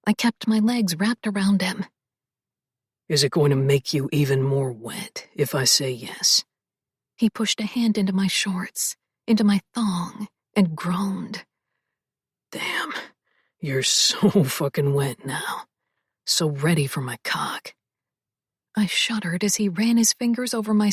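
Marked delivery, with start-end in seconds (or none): 3.1-6.4 low, husky male voice, whispered; lower, intimate man's voice; male love interest voice. Acted. Male lead teasing. 12.5-17.7 low, husky male voice, whispered; lower, intimate man's voice; male love interest voice. Acted. Male lead aroused.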